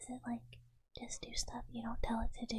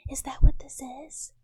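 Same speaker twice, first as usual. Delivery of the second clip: louder, rising offended whisper; 2 semitones higher, 16 dB louder than usual. Acted. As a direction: push it up with mock outrage. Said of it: "I'm offended and shocked".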